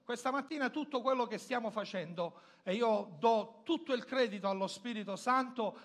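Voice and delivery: normal talking voice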